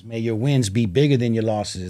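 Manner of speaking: ordinary talk, nothing marked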